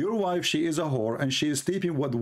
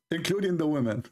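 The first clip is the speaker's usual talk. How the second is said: normal talking voice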